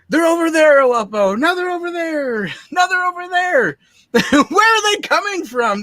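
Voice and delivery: high, loud cry of alarm; frantic alarmed shouting. Retold or imitated. Retelling the film scene to co-hosts, mock panic. Acting out baffled film characters.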